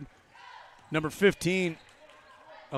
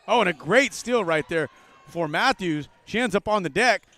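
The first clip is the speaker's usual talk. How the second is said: ordinary talk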